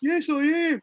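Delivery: high mock newbie voice; squeaky mock reply. Mocking the newbie commenter, high squeak.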